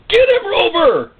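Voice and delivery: high yelled command; calling the dog. Acted. Preacher yells to his bulldog, high and loud. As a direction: sic the dog with a sharp high yell.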